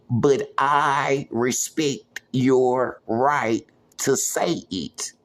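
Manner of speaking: slow, stretched words, every one weighed; slow deliberate delivery. Shown as a demonstration. To critics of his tone, slow and deliberate. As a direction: drag it out slowly to make the point. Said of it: "I'll say it at regular speed".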